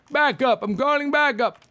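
calling backup, high and loud. Acted. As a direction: shout the order for backup.

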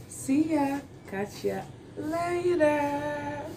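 high, drawn-out cheerful farewell; sing-song goodbye. Acted. Sing-song vlog sign-off.

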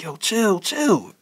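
mildly mad movie-quote voice, high; irritated Martin Lawrence. Retold or imitated. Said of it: "he gets all mad and irritated".

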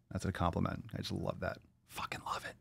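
softly whispered aside; fading to a whisper. Real, not acted. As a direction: trail off into a whisper. Quiet aside about the name.

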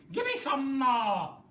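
high, loud, slow cry; Grandma yelling for another dose, loud and greedy; demanding shout. A narrator's reading. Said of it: "she cried".

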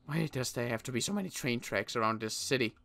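ordinary delivery

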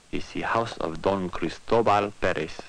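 plain reading voice

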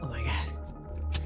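scared whisper. Real, not acted. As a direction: whisper it in dread. Whispered in horror.

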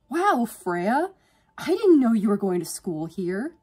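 loud, high, surprised greeting; cheery. A narrator's reading. Old crush bumping into her at college, cheery. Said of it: "The cheery voice".

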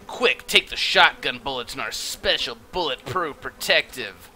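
ordinary talk, nothing marked

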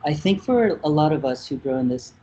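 normal talking voice

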